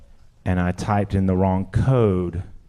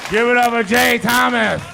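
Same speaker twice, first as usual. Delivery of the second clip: high, loud stage call; announcer shout; 5 dB louder than usual. Real, not acted. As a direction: shout it out to rouse the applause. Calling for applause onstage.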